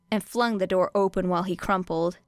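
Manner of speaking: usual speaking voice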